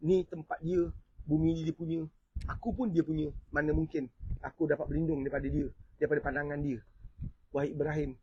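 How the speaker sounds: low, hushed imitation of a weeping penitent; tearful retold plea. A weeping sufi confesses to Ibrahim he cannot hide from Allah, hushed tearful plea. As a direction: plead softly and haltingly, as if choked with remorse. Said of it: "dia menangis, teresak-esak".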